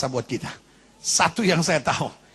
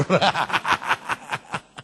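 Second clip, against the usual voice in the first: long breathy laugh; 4 semitones lower than usual. Real, not acted. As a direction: laugh at your own joke. Laughs at own joke. (tertawa panjang)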